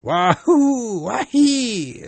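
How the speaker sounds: high, loud whooping war cry; war cry scream. Acted. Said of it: "screamed the Indian in reply".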